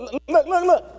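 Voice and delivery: put-on high eager child voice; child saying look. Child pressing father to look at his trick. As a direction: show off like a little kid.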